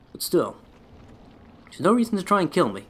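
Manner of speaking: ordinary talk, nothing marked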